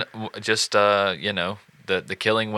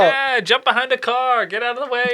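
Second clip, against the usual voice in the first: mock panic; 12 semitones higher than usual. Bystanders dodging the loose bull. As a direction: play a frightened bystander.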